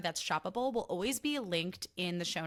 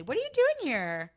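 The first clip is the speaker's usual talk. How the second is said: higher, louder, sneering "What are you doing here?"; condescending; 7 semitones higher, 5 dB louder than usual. Retold or imitated. Mocking a snooty acquaintance at an event, condescending. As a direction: look down on them as you ask. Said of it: "Like, condescendingly".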